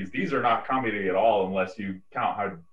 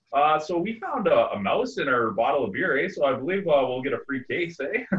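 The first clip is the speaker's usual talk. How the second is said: mock Canadian hoser faking a complaint, eh; 5 semitones higher, 3 dB louder than usual. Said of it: "ultra stereotypically Canadian".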